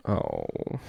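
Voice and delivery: very low, quiet deflated oh. Retold or imitated. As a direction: let the wind out of your sails. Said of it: "he just kinda went".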